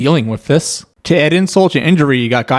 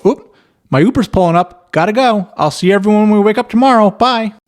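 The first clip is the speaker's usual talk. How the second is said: higher, fast breezy goodbye; hasty dad; 9 semitones higher than usual. Acted. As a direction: rush out cheerfully before anyone objects. Dad hurrying out the door.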